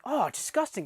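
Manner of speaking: high, squeamish disgust voice; disgusted woman. Retold or imitated. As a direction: recoil in disgust. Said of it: "she's like".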